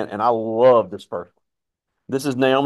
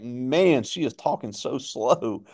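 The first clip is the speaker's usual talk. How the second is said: slow, drawn-out professional pace; slow talking; much slower than usual. Retold or imitated. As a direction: mimic slow newsreader pace. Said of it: "I was even laughing one time".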